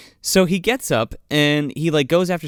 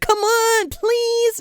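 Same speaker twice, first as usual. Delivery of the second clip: much higher pleading whine; begging; 14 semitones higher, much slower than usual. Retold or imitated. Hero begging the nurse for a date, high whine. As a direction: beg her like a needy puppy.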